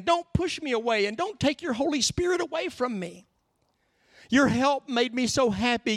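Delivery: high, urgent pleading prayer; begging. Acted. David asking God to erase sins, begging. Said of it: "He's begging".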